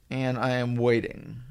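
slow and soft, spelling out "I am waiting"; weary waiting. Retold or imitated. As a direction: let "I am waiting" sag, worn down by the wait. Acting out a long wait.

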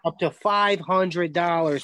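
slow, punchy promo delivery; loud, enthusiastic. Real, not acted. Guest pitching the betting bonus, slow and enthusiastic. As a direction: sell the offer slowly with gusto. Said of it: "Say it with some enthusiasm, loud, baby".

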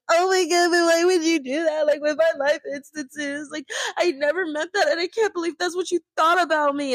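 high, whiny fake sobbing; whiny crying. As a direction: imitate someone crying over it, hammed-up. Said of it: "crying in that person's face".

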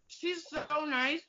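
ordinary talk

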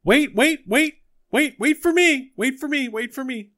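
high, loud pleading cry from afar; high shouted wait for me; distant calling voice. Acted. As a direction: call out desperately from a distance. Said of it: "you hear a voice ring out over the desert going".

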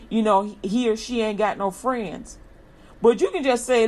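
usual speaking voice

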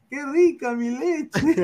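high, slow, laughing catchphrase; high mock ad voice. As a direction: ham up the ad line. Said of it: "y diga".

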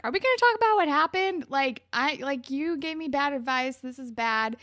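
high, loud start easing off; mock confrontation. Girl confronting her boyfriend after school, mildly angry. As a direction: confront him, mildly indignant.

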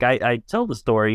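normal talking voice

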